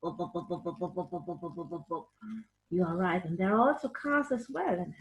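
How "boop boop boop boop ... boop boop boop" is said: soft, low repeated tractor boops; quiet mock tractor engine; tractor noise. Shown as a demonstration. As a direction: putter like a tractor. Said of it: "and the tractor".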